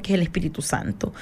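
breathless preacher's whisper. Acted. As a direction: hush it like a secret.

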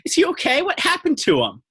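very high-pitched alarmed questioning; acted aghast park ranger; incredulous. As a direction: squeak it out in disbelief. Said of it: "looks at Brandon incredulously".

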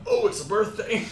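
high, loud "oh, it's a birthday"; sudden delighted discovery; surprised. Retold or imitated. Remembering from calendar.

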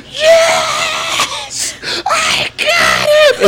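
very high squealed mock triumph, drawn out; hammed-up celebration. Imitating drunk gymnast after sticking landing, squealed celebration. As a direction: mimic losing it with joy.

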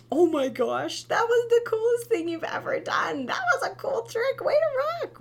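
high, loud, excited praise; celebrating mom. Shown as a demonstration. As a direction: cheer the fall as a great trick. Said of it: "instead just, like".

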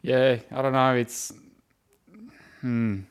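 ordinary delivery

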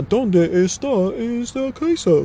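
slow, high, halting bad Spanish accent. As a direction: sound out clumsy tourist Spanish with a bad Spanish accent. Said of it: "bad Spanish".

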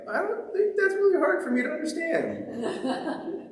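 high, slow sarcastic reply with a laugh; mock-innocent sarcastic answer; mock deadpan reply. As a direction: play dumb, amused and dry. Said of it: "I'm like".

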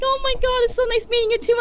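high, loud starstruck gush; gushing fangirl. Retold or imitated. Fan saying goodbye to her idol, gushing. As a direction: gush back, voice pitched right up. Retells gushing back to celebrity.

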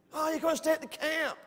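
high-pitched, coaxing camp host; insistent host. Retold or imitated. Voices host pushing his camp.

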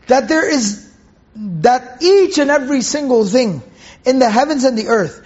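ordinary talk, nothing marked

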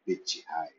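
ordinary delivery, nothing marked

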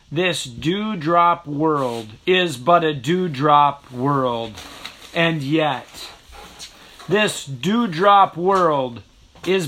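slow, measured haiku recitation; poem reading. Acted. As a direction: let each line hang, unhurried. Reads a haiku aloud.

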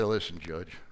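low, soft and unruffled; calm reply. Retold or imitated. Speaker retelling his answer to an irate judge, calm. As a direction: answer the yelling quietly.

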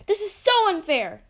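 angry shout at the unfair grade. Acted. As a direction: yell it at the teacher in protest. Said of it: "I shouted".

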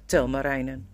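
usual speaking voice

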